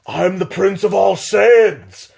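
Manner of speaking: high, slow, grandiose game villain voice; Vegeta boast. Retold or imitated. Vegeta gloating over a beaten opponent, slow grand boast. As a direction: proclaim it slowly like a proud prince. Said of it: "Vegeta looks over and goes".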